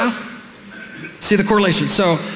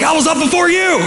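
high-pitched indignant comeback; indignant retort; 12 semitones higher than usual. Acted. Preacher voicing someone beating the alarm, indignant retort. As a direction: throw it back, pitch way up.

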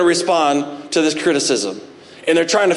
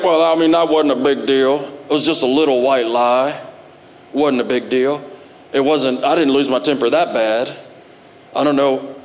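low, shrugging excuse voice; lightly voiced dismissive excuse; mock excuse-maker; 6 semitones lower than usual. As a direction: brush it off like a sinner making excuses. Mocking an excuse-making sinner.

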